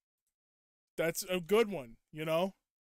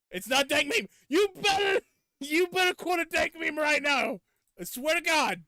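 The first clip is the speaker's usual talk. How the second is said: loud, high, hammed-up yell at chat; mock angry shout; 9 semitones higher, 7 dB louder than usual. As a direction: bark the demand, playfully furious. Streamer yelling demand at chat.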